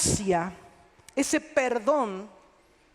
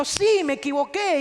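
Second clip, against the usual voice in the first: higher, louder, shrugging tone; dismissive admission; 5 semitones higher, 5 dB louder than usual. Retold or imitated. Unrepentant churchgoer brushing off sin, in a mock dismissive voice. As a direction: toss off the admission like it's nothing. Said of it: "Solamente están diciendo".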